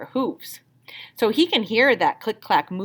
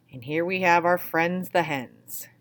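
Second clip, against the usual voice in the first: ordinary delivery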